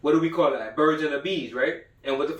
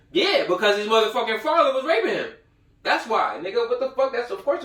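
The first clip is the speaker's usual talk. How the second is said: laughing outrage; 8 semitones higher, 4 dB louder than usual. Real, not acted.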